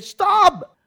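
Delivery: high, loud sudden stop; commanding shout. Acted. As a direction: call it out like a warning from above. Voice from heaven commands.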